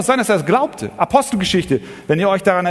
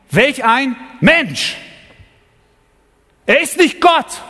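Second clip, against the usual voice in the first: fiery preaching; 10 semitones higher than usual. Acted. Preacher booming Pilate's words at the mob, raised and ringing. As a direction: proclaim it over the crowd like Pilate.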